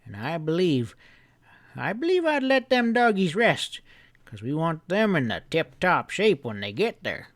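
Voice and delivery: put-on an old storekeeper giving friendly orders; old grandpa voice. Grandpa telling the boy to bring the hounds early, folksy.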